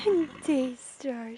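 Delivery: ordinary talk, nothing marked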